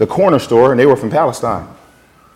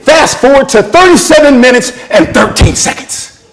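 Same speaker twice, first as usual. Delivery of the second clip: high, emphatic directive; emphatic instruction; 11 semitones higher, 4 dB louder than usual. Retold or imitated. Friends tell Dave where to look on YouTube, emphatic instruction. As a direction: spell out the exact spot insistently. Friends urge him to a video moment.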